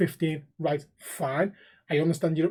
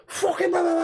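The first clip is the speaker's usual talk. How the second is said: high-pitched ranting gibberish, hammed-up; mock screaming; 12 semitones higher than usual. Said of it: "screaming at me".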